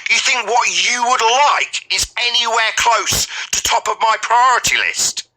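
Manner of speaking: usual speaking voice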